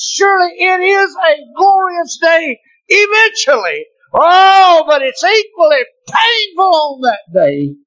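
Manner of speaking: high, ringing preacher's cry building to shouts; fiery preaching. Acted. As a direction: lift the church with it. Preaching at full force.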